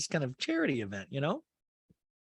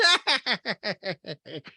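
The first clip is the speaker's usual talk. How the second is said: high, staccato put-on cackle; Rinna; 7 semitones higher than usual. Housewife laughing on FaceTime, mimicked high cackle. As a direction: imitate a long rattling laugh. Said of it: "And Rinna goes".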